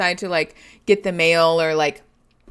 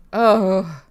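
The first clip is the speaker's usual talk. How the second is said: normal talking voice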